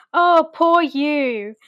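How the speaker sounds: drawn-out pity, laid on thick; mock pity. [name] calling out his privilege.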